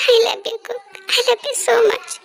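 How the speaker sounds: very slow, sobbing through the words; crying. Acted. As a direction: confess it while weeping. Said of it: "रो मत". (रोते हुए धीमे-धीमे इज़हार)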